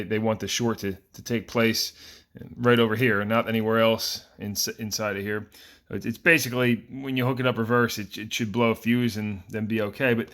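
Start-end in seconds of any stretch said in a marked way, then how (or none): none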